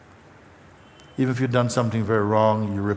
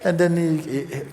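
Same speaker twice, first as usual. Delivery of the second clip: usual speaking voice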